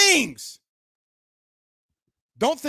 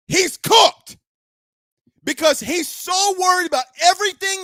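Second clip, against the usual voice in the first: loud, higher furious rant; raised angry voice; 5 semitones higher, 4 dB louder than usual. Real, not acted. Radio host yelling at fans defending the coach, furious shout. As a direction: shout it down at the fans who excuse him. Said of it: "I gotta come out here and raise my voice".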